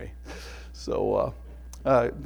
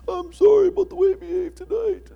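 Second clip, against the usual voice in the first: put-on much higher, meek child voice; small child's apology; 6 dB louder than usual. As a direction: squeak it out like a sheepish little kid.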